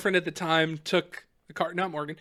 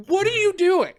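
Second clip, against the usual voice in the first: high-pitched cop question; imitated disbelieving challenge; mock incredulity; 11 semitones higher than usual. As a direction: ask it like an exasperated officer. Retold cop questions him.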